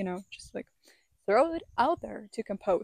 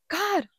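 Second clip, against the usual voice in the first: sharp gasp; 8 semitones higher than usual. Real, not acted. Sudden gasp at fire.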